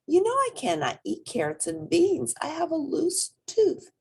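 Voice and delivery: put-on a small child complaining about her tooth; little rabbit voice.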